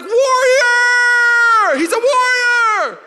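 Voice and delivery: high, drawn-out fanatical scream; hammed-up fan war cry; crowd roar. Voices crowd cheering the kick.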